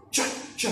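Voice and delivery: whispered "Ch, ch," like a puffing train; ch sound like a train. Shown as a demonstration. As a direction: puff out "Ch, ch," like a steam engine. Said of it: "like a train".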